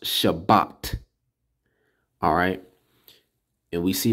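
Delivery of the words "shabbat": Shabbat said slowly, sha-BAT; teacher giving the proper way to say it; correct pronunciation. Shown as a demonstration. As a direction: state Shabbat carefully as the right form. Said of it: "But it's pronounced".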